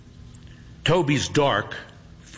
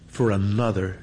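ordinary delivery, nothing marked

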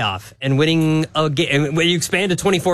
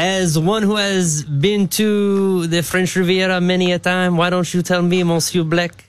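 mock French accent. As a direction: put on a pompous French questioner. Mock French host quizzing Monsieur Blake.

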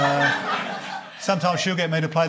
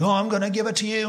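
higher-pitched mock lover's voice; amorous guy next door; 6 semitones higher than usual. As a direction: play a hammed-up man in foreplay. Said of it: "like the guy's like".

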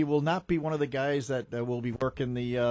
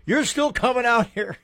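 slow, higher mock old-timer jab; lightly voiced teasing elder; teasing old man; 9 semitones higher, 6 dB louder than usual. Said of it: "he goes".

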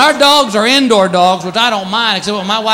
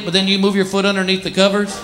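normal talking voice

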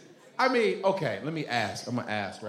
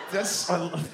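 usual speaking voice

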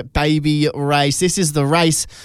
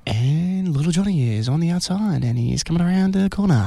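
soothing commentary. Shown as a demonstration. Radio host showing baby race commentary that can't scare the baby, soothing tones. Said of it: "Yes, okay, soothing tones".

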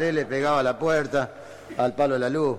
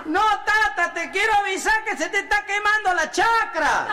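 put-on boy's distant shout; 4 dB louder than usual. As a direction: shout each word so it carries over the fields. Boy hollers back across the fields.

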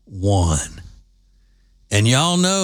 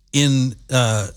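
ordinary talk

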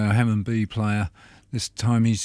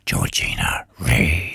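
hoarse, near-whispered; barely any voice left, croaking; losing voice; 8 semitones lower, slower than usual. Real, not acted. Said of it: "My voice is all going down the toilet".

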